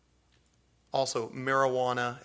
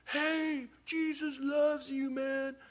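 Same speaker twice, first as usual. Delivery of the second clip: put-on high, slow drunken call from a distance; drunk man heard far off; 11 semitones higher, 4 dB quieter, much slower than usual. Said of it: "we hear this off in the distance".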